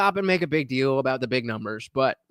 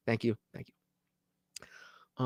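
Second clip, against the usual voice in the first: slow breathy whisper of gratitude, barely voiced; whispered thanks; 2 semitones lower, 11 dB quieter, much slower than usual. Real, not acted. Streamer thanking his chat, in a slow heartfelt whisper. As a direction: hush it right down and thank them slowly.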